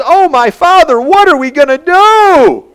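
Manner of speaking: high, loud cry of panic; frightened servant wailing, pitched high; panicked servant. Retold or imitated. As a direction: cry out in fright to your master. Panicked servant cries to Elisha.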